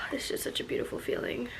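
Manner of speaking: soft, breathy and quick; tender whisper. Real, not acted. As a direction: sigh it out, moved. Sighing about feeling baby move.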